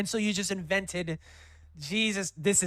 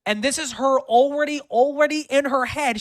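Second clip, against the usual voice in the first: outraged; 10 semitones higher, 9 dB louder than usual. Real, not acted. Outraged realization.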